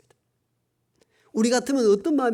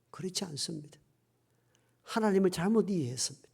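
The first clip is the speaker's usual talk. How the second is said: whisper; 2 semitones lower, 8 dB quieter than usual. Real, not acted. Whispered correction.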